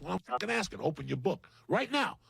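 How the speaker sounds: retold demand, high and loud; angry demand. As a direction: push the order hard, acting out a quarrel. Acted phone quarrel, demanding.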